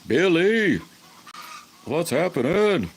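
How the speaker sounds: high, loud call across the room; loud greeting. Real, not acted. Streamer greeting viewer Billy, loud cheery call. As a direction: hail a buddy who just showed up.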